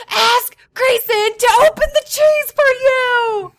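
slow, high, loud reenacted holler; yelling. Fed-up mom to son pounding on door, drawn-out retold yell. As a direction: yell each word through a door. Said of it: "Grayson hears me yelling his name".

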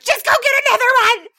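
fast, high, loud retold outburst; seething. Seething mom to son at the door, rapid reenacted yell. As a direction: snap it out in one furious burst. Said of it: "I'm, like, seething".

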